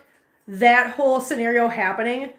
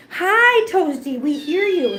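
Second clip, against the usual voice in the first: high cheery hello; 5 semitones higher, slower than usual. Real, not acted. Greeting Toasty the cat, sing-song hello. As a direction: call out a happy hi to the cat.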